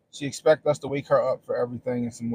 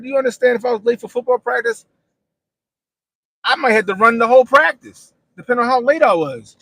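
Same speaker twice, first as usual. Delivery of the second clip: higher and loud, scolding; loud lecture; 10 semitones higher, 12 dB louder than usual. Retold or imitated. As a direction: lecture her, loudly. Lecturing daughter about football.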